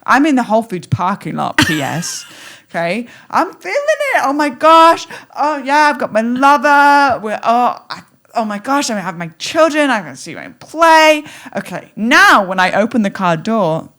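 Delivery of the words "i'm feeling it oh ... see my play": high, loud gushing joy; high, breathless thrill of a dream come true; giddy excitement. Pretend. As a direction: act out being overjoyed in the car. Acting out joy in parking lot.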